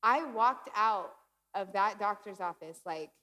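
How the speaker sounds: ordinary talk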